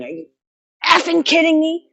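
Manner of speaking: high, exasperated mock yell; retold exasperated yell. Frustrated at the fence, a high exasperated yell. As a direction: squeak it out, fed up. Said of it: "you could hear me out there going".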